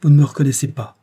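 loud indignant exclamation; startled loud cry of reproach. A narrator's reading. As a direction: call it out in shock. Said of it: "s'écria George".

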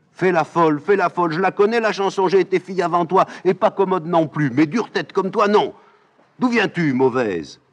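put-on old nurse loudly teasing and scolding; mocking scolding nurse.

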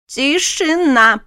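sharp, slow ringing command; ringing shout. A narrator's reading. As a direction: call the hall to silence. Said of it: "зазвенел голос профессора Гарейн".

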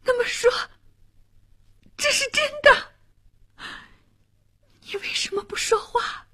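very high, distraught, halting; shaken, changed voice. A narrator's reading. Girl backing away realises he loves another, trembling shock. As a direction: let the shock lift the voice high, in broken phrases. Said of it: "连声音也变了".

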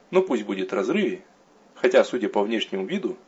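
ordinary delivery

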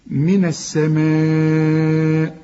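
السماء with the alif stretched four beats; long madd recitation. Shown as a demonstration. Teacher chanting the long madd for students. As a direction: stretch the vowel before the hamza. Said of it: "لازم تمد المد ده أربع حركات".

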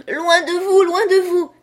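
put-on shrill, very high, loud scream of a child; a little girl screaming away from her governess; child's cry. A narrator's reading. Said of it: "cria-t-elle".

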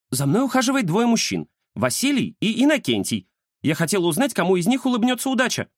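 high-pitched woman client's voice; eager client, journalist. Acted. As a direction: ask the fortune-teller in a high, eager voice. (высокий голос посетительницы гадалки)

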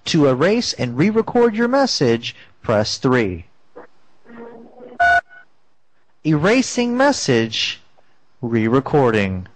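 answering machine voice. Pretend. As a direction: recite menu like a robot system. Fake voicemail menu.

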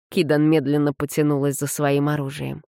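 ordinary delivery, nothing marked

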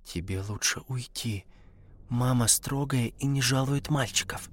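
put-on narrated girl's whisper, very soft. A narrator's reading. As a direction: whisper it as a cautious warning. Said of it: "Даша сказала шёпотом".

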